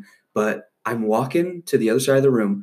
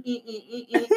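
high squeaky mock violin noises; violin screech; 12 semitones higher, 7 dB quieter than usual. Imitating violins being played.

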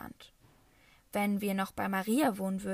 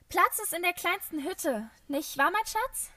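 much higher, sing-song calling out; cheerful call; 10 semitones higher than usual. A narrator's reading. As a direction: Maria sings out cheerfully from the stairwell. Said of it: "rief Maria". (hoch und fröhlich rufend)

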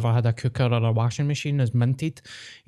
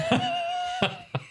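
high-pitched laugh; 5 dB quieter than usual. Real, not acted. Laughs after the joke.

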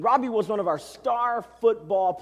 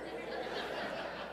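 breathy laugh; 16 dB quieter than usual. Real, not acted. Laugh at football player's size.

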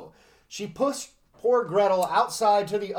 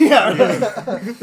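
laughing; 3 semitones higher, 9 dB louder than usual. Real, not acted. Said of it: "laughing after the witch voice".

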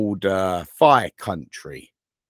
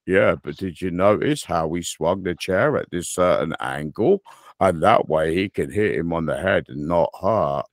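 lower, deflating spoilsport explainer; mock know-it-all; 5 semitones lower than usual. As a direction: imitate a friend drily explaining the trick. Imitating know-it-all friend's explanation.

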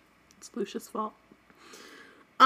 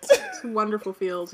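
normal talking voice

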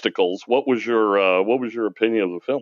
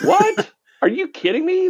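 high incredulous What; shocked, can't believe it; surprised disbelief. Real, not acted. Disbelief at friend's opinion.

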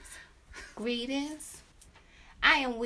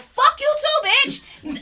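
high, loud retort; snapping back; 13 semitones higher, 8 dB louder, much slower than usual. Retold or imitated. Queen snaps back at the rude friend, high and loud. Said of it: "So Queen was like".

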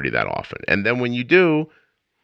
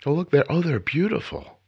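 ordinary talk

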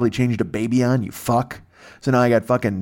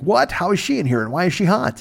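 mock surprise; 8 semitones higher than usual. Everybody surprised at Munster chick.

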